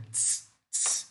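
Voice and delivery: unvoiced "Tss" hiss like the t in tia; voiceless ts hiss, no vowel; soft hissed ts; ts sound. Shown as a demonstration. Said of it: "ó, vejam".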